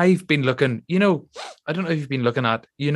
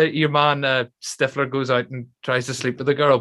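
ordinary talk

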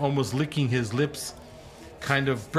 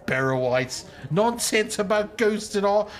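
high squeaky voice with laughter, dismissive; a cackling little rogue calling them cracked; halfling voice, laughing scorn; 8 semitones higher than usual. Acted.

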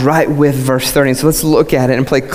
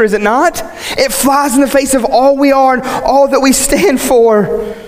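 high, emphatic pulpit delivery; impassioned preaching; 9 semitones higher than usual. Acted. Preacher exalting the bridegroom before the congregation, impassioned. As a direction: proclaim it, stretching the key phrases.

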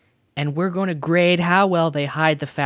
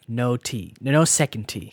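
normal talking voice

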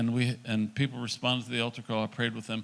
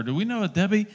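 raised-pitch retold call; mild acted yell, pitched up; 6 semitones higher, faster than usual. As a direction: call the question to someone in another room. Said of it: "I yelled in at her".

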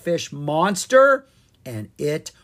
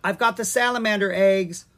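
calling back; 6 semitones higher, 5 dB louder than usual. Acted. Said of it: "Owen called back".

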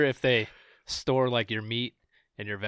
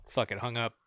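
ordinary delivery

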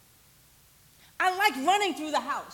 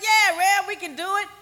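louder, higher, enthusiastic yes; eager agreement; 5 semitones higher, 6 dB louder than usual. Retold or imitated. School helpers answering the pastor's request, eager. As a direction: imitate keen volunteers saying yes.

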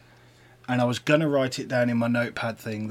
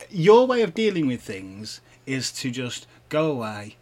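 ordinary delivery

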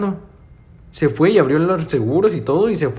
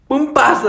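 high, louder mock shot sound; gunshot noise; 11 semitones higher, 4 dB louder, slower than usual. Reliving the impossible shot for his friend, mock gunshot. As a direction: bang it out like a cartoon shot.

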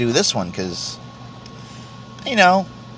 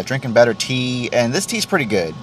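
usual speaking voice

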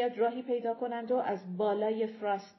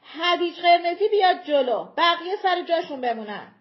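loud, higher order thrown down the slope; commanding shout; 7 semitones higher, 10 dB louder than usual. Acted. Leader shouting down at the giants, loud command. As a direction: bark out terms from the ridge. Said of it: "کریستوبرت داد زد".

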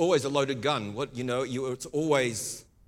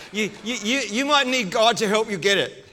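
laughing; 7 semitones higher, 4 dB louder than usual. Real, not acted. Laughing through his own joke.

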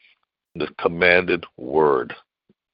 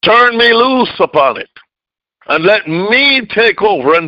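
high, loud preaching cry; preaching at full force; 13 semitones higher, 4 dB louder than usual. Acted.

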